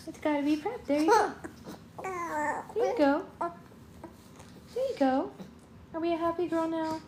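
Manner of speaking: high, slow cooing. Real, not acted. Mother feeding her baby, high slow coo. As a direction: stretch it out high and sweet.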